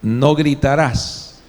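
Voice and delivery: No gritarás said very slowly, each word weighted; slow rule. Shown as a demonstration. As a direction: lay down no gritarás like a law. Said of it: "le puedo poner como norma". (pronunciado lento y solemne)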